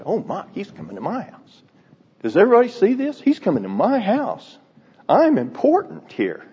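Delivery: a light proud boast; hammed-up pleased self-importance; mock self-important. Preacher imitating his own proud reaction, mock pompous. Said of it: "I would have said".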